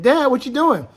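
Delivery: put-on puzzled kid asking dad; son asking.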